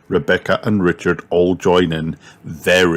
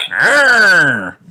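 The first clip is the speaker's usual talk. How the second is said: high, loud mock roar; dinosaur roar; 12 semitones higher, 6 dB louder than usual. A narrator's reading. Piglet playing dinosaur, high mock roar. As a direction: roar like a toddler playing monster.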